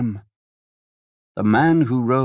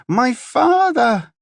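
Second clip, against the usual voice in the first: very high, slow, drawn-out wail; shrieking wail; 4 dB louder, much slower than usual. A narrator's reading. The daughter wails for her murdered father, very high and slow. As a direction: stretch "my father" into a shrieking lament. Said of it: "She was shrieking and flailing".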